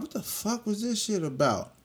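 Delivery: high, baffled outrage; outraged disbelief. Retold or imitated. Venting to mom about the arrest, high outraged disbelief. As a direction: throw the question up in frustration.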